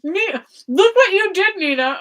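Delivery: talking through a mouthful; words round a bite of food, high; mouth full. Real, not acted. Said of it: "I'm talking with my mouth full".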